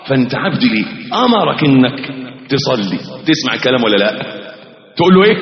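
ordinary talk

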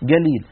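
"قليل" تنطق galiil; القاف g في "قليل"; g for qaf. Shown as a demonstration. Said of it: "لما نقول قليل". ("قليل" بصوت g)

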